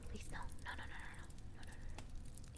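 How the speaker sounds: unvoiced hushed no no no; whispered pleading no. Real, not acted. Pleading with pet.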